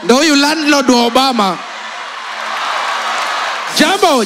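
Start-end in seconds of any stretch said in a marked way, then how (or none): none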